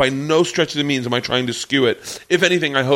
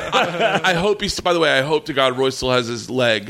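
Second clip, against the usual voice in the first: ordinary delivery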